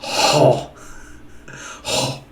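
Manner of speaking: HO with a rough, heavily breathed H; phlegmy H. Shown as a demonstration. Showing the Greek breathing mark, HO with a phlegmy H. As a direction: push out "ho" with phlegm on the H. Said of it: "some good old, some phlegm".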